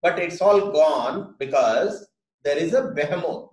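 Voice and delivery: ordinary talk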